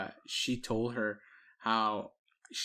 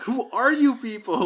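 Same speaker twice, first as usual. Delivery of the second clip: much higher, louder, slowed disbelief; hammed-up shock; mock astonishment; 12 semitones higher, 10 dB louder, slower than usual. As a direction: gape at them in disbelief. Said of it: "I'm like".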